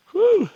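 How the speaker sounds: relieved whew. Real, not acted. Reaching end point after hike.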